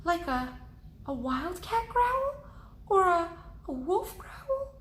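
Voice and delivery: high, halting frightened question; scared. Acted. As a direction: stammer in fear. Jack scared in the woods.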